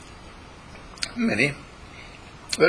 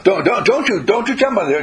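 put-on high, louder child's scold; little girl scolding; 8 semitones higher, 4 dB louder than usual. Little Ellen scolding Rex, high and loud.

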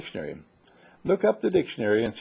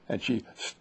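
usual speaking voice

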